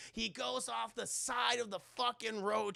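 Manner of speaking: usual speaking voice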